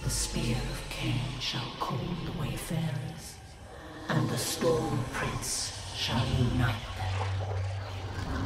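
soft, eerie whispered chant; hushed prophetic recitation; whispered prophecy. Acted. Hushed prophecy before the narration.

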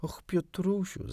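high, drawn-out call of his name; girl calling to the boy, lightly voiced; eager cry. A narrator's reading. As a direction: call out to him, longing. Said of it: "zawołała".

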